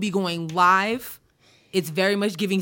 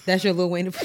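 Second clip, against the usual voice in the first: normal talking voice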